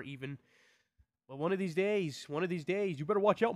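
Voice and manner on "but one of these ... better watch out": a hint of menace, louder and higher; mild mock challenge; playful threat. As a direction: warn the big channels, half joking. Mock threat to big YouTubers.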